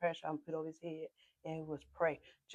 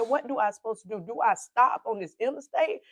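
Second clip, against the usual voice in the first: distressed retold plea; 4 semitones higher, 9 dB louder than usual.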